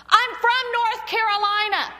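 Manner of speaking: high, loud mock announcement; proud boyfriend's declaration. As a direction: blurt it out proudly, imitating a boyfriend. Retells boyfriend's sudden declaration.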